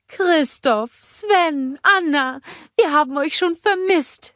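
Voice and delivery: cheerful motherly troll greeting; Bulda's voice. A narrator's reading. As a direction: welcome them home warmly.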